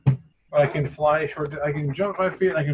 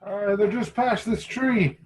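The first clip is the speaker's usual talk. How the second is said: lightly acted, higher-pitched adventurer; higher character voice answering a shout; in-character lookout reporting back; 9 semitones higher than usual. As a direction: call the sighting back to a companion.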